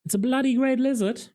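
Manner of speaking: high, loud jeer; mock the creature scornfully; sneering taunt. A narrator's reading. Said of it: "they jeered".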